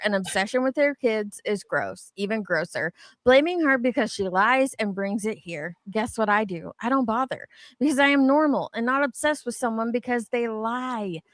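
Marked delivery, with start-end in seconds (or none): none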